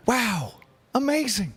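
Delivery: gasp, then high breathy wonder; a kid seeing snow for the first time; childlike awe. Retold or imitated.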